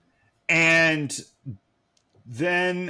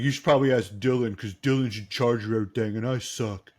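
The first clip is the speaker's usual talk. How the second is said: whiny Brandon; faster than usual. Retold or imitated. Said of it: "he's like".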